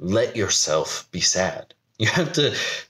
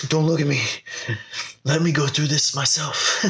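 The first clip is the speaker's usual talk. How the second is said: laughing; 8 semitones higher than usual. Real, not acted. Laughing at own need for privacy.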